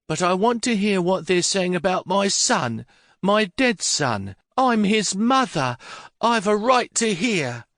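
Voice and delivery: put-on high, tearful-edged old lady insisting; grieving old mother. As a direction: claim her right as his mother. Mother demands to hear about son.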